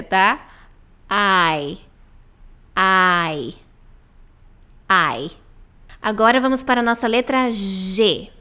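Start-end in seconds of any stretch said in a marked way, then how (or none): 1.1-5.3 "I" drawn out as eye. Shown as a demonstration. Teacher drilling English letter I, ai sound. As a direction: say "I" like Portuguese "ai", gliding down. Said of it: "É a mesma pronúncia".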